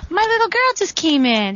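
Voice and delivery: high, delighted surprise; delighted gasp. Real, not acted. Baby enters the room.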